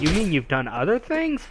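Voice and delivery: ordinary talk, nothing marked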